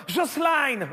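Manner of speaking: mock Portuguese accent, Jocelyne as "Joss-line"; Christina Cordula. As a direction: say "Jocelyne" high with a Portuguese twang ending "-aïne". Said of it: "un, un accent portugais". (« Jocelyne » aigu, accent portugais)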